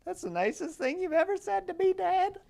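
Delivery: put-on high, gushing kid voice; sappy kid. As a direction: gush like a touched child. Reading the kid's sappy text.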